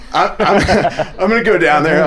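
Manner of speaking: a giddy, laughing resolve. Retold or imitated. Nervous laughing plan.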